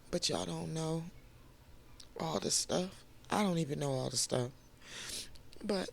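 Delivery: tearful, high and soft through tears; catching breath midword while crying. Real, not acted. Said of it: "I'm sorry, I'm crying".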